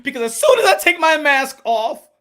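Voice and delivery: high, loud, indignant mock fighter; mocking Charlo impression. Mocking the fighter who demands vaccines, high loud indignation. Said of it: "And people be like".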